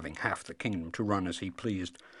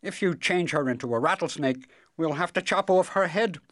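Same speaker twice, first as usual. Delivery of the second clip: louder, hurried royal objection; hasty king; 8 semitones higher, 8 dB louder than usual. A narrator's reading. King stops the wizard trying again, hastily. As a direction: cut in firmly as the king. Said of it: "the king said quickly".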